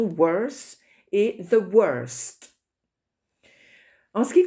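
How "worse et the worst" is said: «worse» and «the worst» said very slowly, clearly separating the two words; slow, deliberate model to contrast «worse» with «the worst»; careful pronunciation. Shown as a demonstration. Teacher warning students to pay attention, slow careful model. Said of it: "faites attention".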